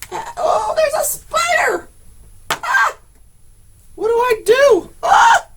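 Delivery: panicked high-pitched shrieks; real fright, shrieking loud and high; screaming at a spider. Real, not acted.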